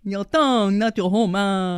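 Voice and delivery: hammed-up Kentucky twang; Kentucky accent. Shown as a demonstration. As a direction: imitate a Kentucky accent with a tight tongue. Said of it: "a little bit more from Kentucky".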